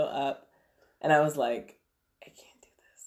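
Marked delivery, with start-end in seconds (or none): 2.2-3.1 barely audible, worn-out whisper; exhausted whisper. Retold or imitated. Mom giving up on sleep at night, exhausted whisper. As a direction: whisper it in tired defeat.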